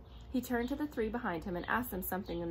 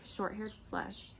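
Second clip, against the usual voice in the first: ordinary delivery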